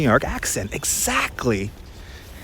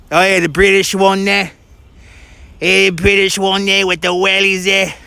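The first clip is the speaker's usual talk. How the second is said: higher, playful mock British accent; put-on British accent; 4 semitones higher than usual. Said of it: "My British accent has definitely improved".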